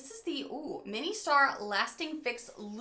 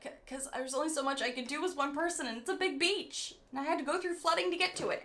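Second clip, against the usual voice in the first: higher-pitched acted comeback to critics; defensive retort; 5 semitones higher, faster than usual. Vlogger answering TikTok critics who wanted more, defensive retort. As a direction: protest your case in a raised pitch.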